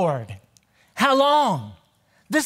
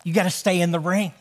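ordinary delivery

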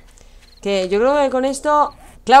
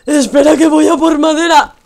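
loud hurried call; 6 semitones higher, 9 dB louder than usual. Real, not acted. Rushing off for wood.

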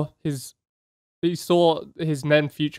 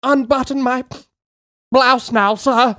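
higher, timid plea to an officer; dying soldier; 8 semitones higher than usual. Retold or imitated. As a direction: ask the sir meekly, lightly voiced. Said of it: "the guy was like".

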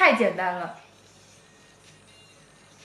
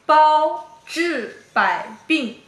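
slow exaggerated mouthing; 2 semitones higher, much slower than usual. Shown as a demonstration. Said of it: "看着啊".

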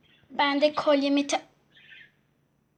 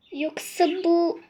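ordinary talk, nothing marked

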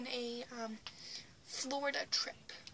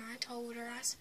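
ordinary delivery

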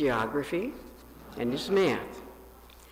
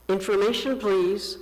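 caller's request, higher and louder; calling the operator; 6 semitones higher, 6 dB louder than usual. A narrator's reading. Grown Paul calls the operator again, lightly raised voice. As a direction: ask the operator brightly. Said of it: "and said".

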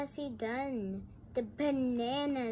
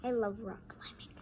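whispered aside; faster than usual. Real, not acted. Whispered aside while reading.

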